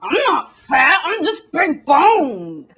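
hammed-up defensive wail, dragged out at the end; loud spoilt-glutton protest; mock American. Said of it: "Americans are all like".

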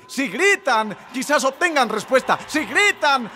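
rising full-force preaching, climbing to a high held cry. Real, not acted. Preacher telling the crowd what shouting could bring, building preaching.

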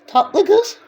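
high, bright burst of delight; delighted squeal. Real, not acted. Tasting mother's dessert for viewers, delighted squeal. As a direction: squeal it out after the first bite.

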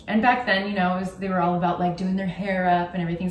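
ordinary talk

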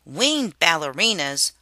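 loud, dry repeat; mocking echo. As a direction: sneer her phrase back. Narrator mocks her phrase.

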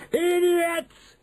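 very high, screeched insult; angry shout. A narrator's reading. Grand High Witch scolding her audience, shouting. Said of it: "shouted the Grand High Witch".

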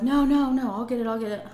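rushing no, no, no, loud then easing; urgent calling out. Retold or imitated. Mom calling the kids back from the door, urgent. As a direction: stop the kids before they open the door.

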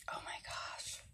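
barely whispered, under the breath; whispered exclamation. Real, not acted. Pausing mid-complaint after a sudden ow, whispered. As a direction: whisper it to yourself, very softly.

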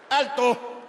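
high, loud halt order; short, forceful shout to stop; policeman's command. Acted. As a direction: bark it like a traffic cop. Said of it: "dijo el carabinero".

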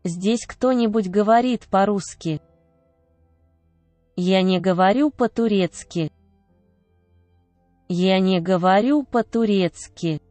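ordinary talk, nothing marked

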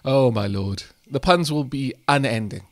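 ordinary talk

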